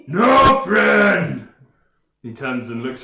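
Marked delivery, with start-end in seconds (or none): 0.1-1.5 loud, high ogre bellow; ogre protest. Acted. Ogre after his hand went through knight, roaring protest. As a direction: roar it as a thwarted ogre.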